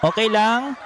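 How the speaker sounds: high, bright mock of the owner's Tagalog; mimicking the owner. Vlogger quoting the restaurant owner's Tagalog, high mock voice. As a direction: echo the owner's surprise Tagalog line, lifted high. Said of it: "Sabi niya lang".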